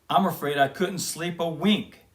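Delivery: plain reading voice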